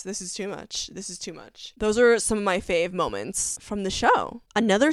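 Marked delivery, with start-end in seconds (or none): 0.0-1.7 overwhelmed fading. Retold or imitated. Overwhelmed by sad scene.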